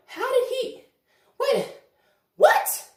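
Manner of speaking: very high, squeaky disbelief; high, shrill disbelief; shocked. Retold or imitated. Shocked at accusation.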